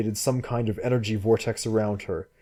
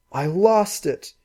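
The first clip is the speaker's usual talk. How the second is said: crying; 10 semitones higher, 4 dB louder, slower than usual. Acted.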